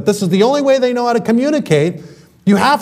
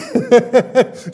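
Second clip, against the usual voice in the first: a high acted laugh of joy; a short higher tearful laugh; joyful laugh; 5 semitones higher than usual. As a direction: laugh as if overwhelmed with relief. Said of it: "She goes".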